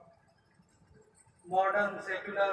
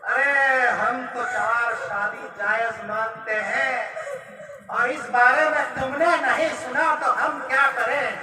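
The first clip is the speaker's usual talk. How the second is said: loud, emphatic self-justification; brazen retort; 3 semitones higher, 5 dB louder than usual. Acted. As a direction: brush off the accusation at high volume. Husband brazenly defends himself.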